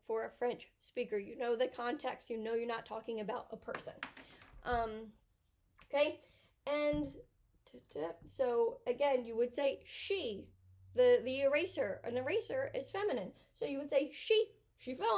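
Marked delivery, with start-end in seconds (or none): none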